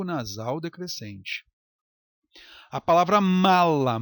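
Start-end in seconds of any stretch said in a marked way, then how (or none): 3.2-4.0 "mala" com o M inicial firme e destacado; M consonantal. Shown as a demonstration. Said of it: "tem que ser consoante". ("mala" forte, com M de consoante)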